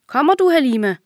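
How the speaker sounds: high, loud call from up ahead. A narrator's reading. Friend calling back to Halima on the path. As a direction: shout to hurry her friend along. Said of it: "råber Khadija".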